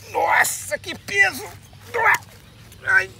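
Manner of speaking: high-pitched, real amazement; startled exclamation. Real, not acted. Angler trying to lift the heavy fish, high startled exclamation. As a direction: exclaim high as the weight hits you.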